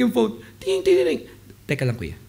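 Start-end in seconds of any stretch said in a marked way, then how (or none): 1.7-2.2 imitated low, soft aside; lower, softer "hold on" to the listener; composed aside. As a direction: drop out of the crying into a quiet excuse. Crying caller pauses for phone.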